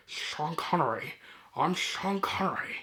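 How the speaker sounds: hushed, slow, bad celebrity impression; terrible Sean Connery. Vlogger introducing Red October, bad hushed impression. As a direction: murmur it slowly in a hammy put-on voice. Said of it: "That's my terrible Sean Connery impression".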